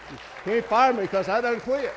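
crowd-pleasing laughing repeat. Real, not acted. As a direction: repeat the joke still chuckling. Repeat after laugh, louder.

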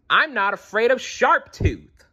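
high-pitched storybook shout at moderate volume; defiant shout. A narrator's reading. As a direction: pipe up in loud bravado. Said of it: "she shouted".

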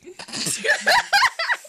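shrieking laugh, high and loud; high burst of laughter. Real, not acted. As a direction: break into a squealing laugh. Reacting to the gross yogurt shot.

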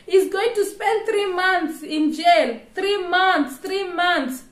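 high, loud protest; indignant emphasis. Real, not acted. Vlogger protesting to viewers about the jail term, indignant.

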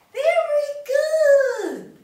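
high, loud, drawn-out cheer; delighted praise. Real, not acted. Praising children after repetition.

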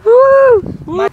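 high loud cheer; excited woo. Real, not acted. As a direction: whoop at the catch. Fish caught on line.

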